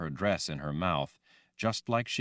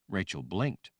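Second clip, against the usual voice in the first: ordinary delivery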